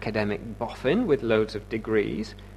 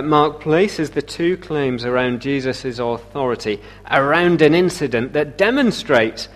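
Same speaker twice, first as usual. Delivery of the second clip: ordinary delivery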